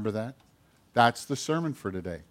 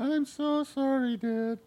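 much higher, syrupy "sorry, Dad"; sugary fake apology; 12 semitones higher, 6 dB quieter, slower than usual. Son sweet-talking Dad into forgiving him, high and syrupy. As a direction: lay it on thick to fool him.

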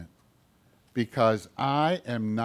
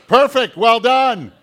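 loud, slow mocking "well done"; sarcastic praise; 10 semitones higher, 11 dB louder, slower than usual. Bratty brother mocking his fallen sister, sarcastic and loud. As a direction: jeer at her like a smug brother.

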